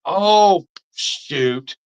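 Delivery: a loud, high Oh as it suddenly hits him; sudden surprise. Real, not acted. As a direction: caught off guard, blurting it out. Sudden realization mid-video.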